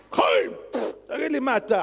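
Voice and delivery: loud, high call out of a name; shout. Acted. Preacher mock-shouting at a drinking friend, loud and high. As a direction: shout across at him.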